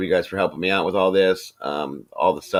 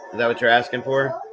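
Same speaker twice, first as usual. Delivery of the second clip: ordinary talk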